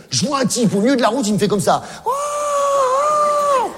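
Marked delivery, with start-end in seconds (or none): 2.1-3.7 high, long called-out "oh, oh"; drawn-out hailing shout; calling out. Retold or imitated. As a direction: hail him from the roadside, high. Bernard flagging him down on road.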